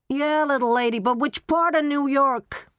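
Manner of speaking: put-on a thick Brooklyn accent, brisk and loud. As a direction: cab rank attendant with a thick Brooklyn accent. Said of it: "with a thick Brooklyn accent".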